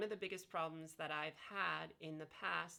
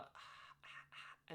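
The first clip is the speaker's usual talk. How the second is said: breathy whispered ahs; soft whispered exercise ahs; 12 dB quieter, much slower than usual. Shown as a demonstration. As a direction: feel the larynx move on a whisper. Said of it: "So you can go".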